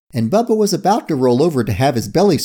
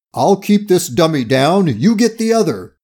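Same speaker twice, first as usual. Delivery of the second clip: raised voice giving orders; calling out; 5 semitones higher than usual. A narrator's reading. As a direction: call instructions to the others. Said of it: "Kojak called to Curie".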